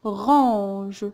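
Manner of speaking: "Orange" drawn out as o, ran-ge; syllable by syllable. Shown as a demonstration. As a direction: sound out "Orange", separating o from a long ran-ge. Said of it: "Oui, j'entends". (« Orange » découpé : o, ran-ge)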